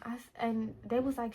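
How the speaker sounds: usual speaking voice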